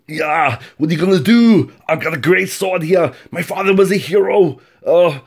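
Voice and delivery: put-on high, loud Austrian-accented action-hero voice; Arnold Schwarzenegger. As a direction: go over the top with a booming Austrian accent. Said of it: "he talks like Arnold".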